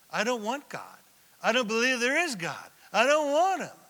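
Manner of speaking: rising to a loud, high defiant shout; defiant refusal. Retold or imitated. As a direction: push back harder on each line. Unbeliever rejecting God, voiced.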